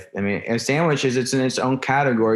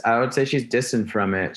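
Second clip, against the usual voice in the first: usual speaking voice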